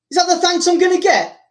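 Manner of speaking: 高音调的不服气质问; 觉得好心没好报，委屈又恼火地反问; 愤懑反问. Acted. Acted indignant quote after being hit.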